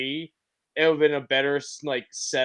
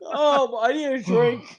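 after laughing hard; 7 semitones higher than usual. Real, not acted. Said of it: "my face hurts from laughing".

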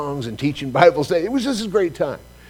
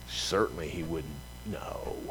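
hushed aside; 8 semitones lower, 13 dB quieter, slower than usual. Retold or imitated. Muttered prayer to the Lord.

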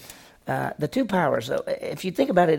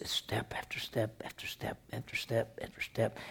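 hushed whisper of the grind; 4 semitones lower, 14 dB quieter than usual. Shown as a demonstration. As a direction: breathe the words out, barely audible. Describing the grind of distance running.

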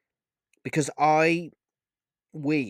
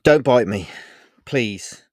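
sharp annoyed warning, louder; 10 dB louder, slower than usual. Acted. Olivia warns Vega not to bite, annoyed. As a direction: snap at him to back off. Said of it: "tone of annoyance in her voice".

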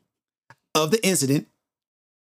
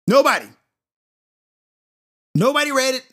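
ordinary talk

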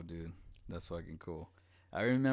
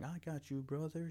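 higher, gentle little sister; 9 semitones higher than usual. Retold or imitated. Little sister helping injured brother, higher gentle voice. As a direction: play the kid sister helping him up. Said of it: "she just started helping me, like".

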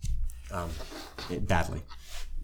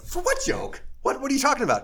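sharply higher, startled disbelief; baffled. Retold or imitated. Kid told everyone is mad at him, surprised. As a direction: caught completely off guard, pitched way up.

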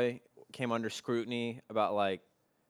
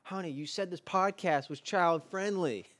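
scolding parent; 9 semitones higher, 3 dB louder than usual. Pretend.

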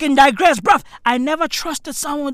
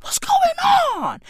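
mock disbelief; 9 semitones higher than usual. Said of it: "I was like".

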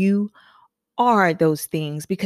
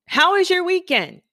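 higher, bright and friendly; cheery question; 12 semitones higher than usual. Acted. Hairstylist asking her client brightly. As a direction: ask it upbeat.

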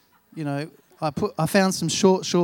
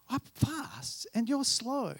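put-on little kid voice; 6 semitones higher, 6 dB quieter, slower than usual. Dad voices three-year-old son.

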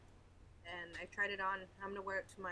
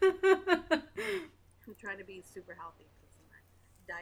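laughing; 11 dB louder, much slower than usual. Real, not acted. Laughs at her own joke.

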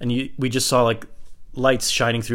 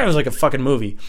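ordinary talk

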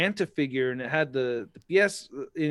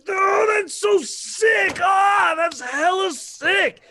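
high, slow, shouted gush over a toy display; overjoyed fan shouting at a display; excited gushing; 13 semitones higher, 4 dB louder, much slower than usual. Retold or imitated. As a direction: gasp it out like a kid seeing the coolest toy. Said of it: "I was like".

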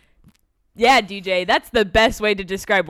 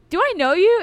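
puzzled; 10 semitones higher, 6 dB louder than usual. Retold or imitated. Retold puzzled question.

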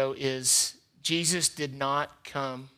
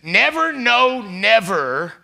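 high loud NEVER, then "no, never" drawn out slowly; emphatic never; 6 semitones higher, 8 dB louder, slower than usual. Shown as a demonstration. Pastor stresses that believers never walk in darkness. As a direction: stretch "never" out. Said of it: "Greek double negative".

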